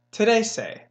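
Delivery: "today say" run together like trece. Shown as a demonstration. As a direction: blend "today say" into one word. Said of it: "and then you're saying something".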